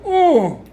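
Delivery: high loud mock grunt of pain; a short hammed-up ooh of impact; imitated pained grunt. Retold groan of Rude hitting cement.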